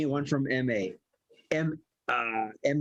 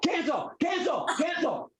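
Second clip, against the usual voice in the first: frantic shouting; 13 semitones higher than usual. Real, not acted. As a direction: yell it at the speaker to stop it, fast. Shouting at smart speaker to stop.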